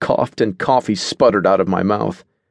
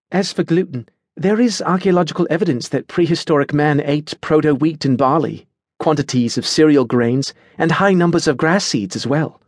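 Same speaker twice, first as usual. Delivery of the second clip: higher-pitched, informative female character voice; lecturing Adelaide; 7 semitones higher than usual. A narrator's reading. Adelaide tells Natasha about prehistoric diets, lecturing. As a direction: lay out the evidence like a keen lecturer.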